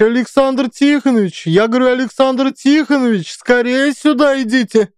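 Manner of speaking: high shrieking call, pressing harder. A narrator's reading. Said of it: "начинает верещать на весь подвал".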